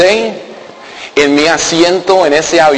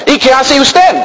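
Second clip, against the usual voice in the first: higher, lightly voiced woman; imitating the woman; 8 semitones higher than usual. Pastor imitating Marilyn asking his job, higher. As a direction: ask it like a curious seatmate. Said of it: "ella me pregunta".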